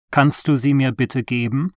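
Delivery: ordinary talk, nothing marked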